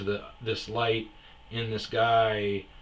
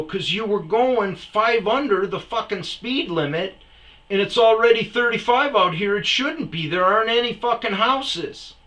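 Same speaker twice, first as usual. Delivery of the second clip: higher and louder ranting; angry yelling; 11 semitones higher, 9 dB louder than usual. Retold or imitated. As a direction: tear into him about the speed limit. Arguing with slow driver.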